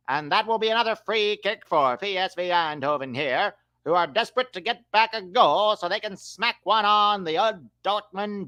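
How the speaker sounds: transatlantic announcer with a hammed-up radio lilt; 1930s advertiser man. Commentating a football match as a 1930s advertiser man. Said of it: "I'm gonna do the next few minutes as in my, uh, uh, nineteen thirties advertiser man voice".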